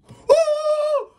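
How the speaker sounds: sudden very high "ooh!", real fright; a yelp when the mouse pops up; startled squeal. Real, not acted.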